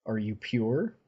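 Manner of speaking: "Are you pure?" asked lightly with a rising end; a plain question tilting up on "pure"; asking angel. Retold or imitated. Avacyn asking Mocker, a light rising question. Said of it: "she looks down at Mocker and says".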